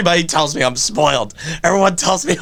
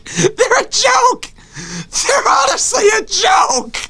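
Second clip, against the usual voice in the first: exasperated shout; slower than usual. Real, not acted. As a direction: wail it in comic disbelief. Mock despair over Yankees.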